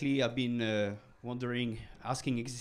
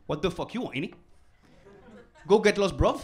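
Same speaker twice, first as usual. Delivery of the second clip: louder, higher London street snap; London street accent; 6 semitones higher, 8 dB louder than usual. Retold or imitated. Lady in a pub rejects him, London accent. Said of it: "she said".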